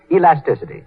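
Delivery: ordinary talk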